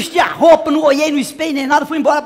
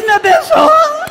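high, slow drawn-out cry to heaven; horrified wail; 11 semitones higher, much slower than usual. Retold or imitated. Woman seeing herself waxed in mirror, horrified wail. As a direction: wail it high, stretching it in comic horror. Horror at mirror, calls on Jesus.

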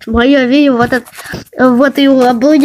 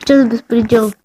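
normal talking voice

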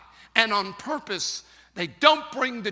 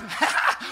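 breathy delighted laugh; happy airy laugh; eaglet's laugh; 2 semitones lower than usual. Acted. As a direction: laugh with joy at flying. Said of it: "he starts laughing".

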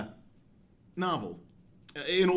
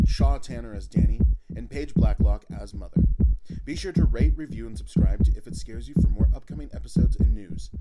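loud, steady podcast outro; announcer reading the show credits, loud and clear; 11 dB louder than usual. Acted. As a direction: read the end credits to listeners.

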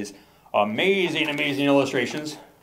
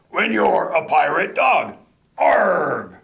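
slow, hammed-up pirate chant; pirate refrain; 3 semitones higher, 3 dB louder, slower than usual.

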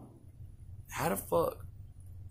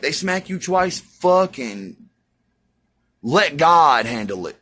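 fiery preaching; 4 semitones higher, 8 dB louder than usual. Acted. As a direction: preach it to the crowd, full force. Preaching at full force.

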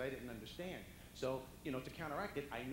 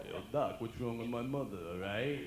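lightly voiced street tough brother; tough-guy impression of Rocky; 8 dB louder than usual. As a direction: ask the doctor like a tough guy from the block. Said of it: "it was a good impression".

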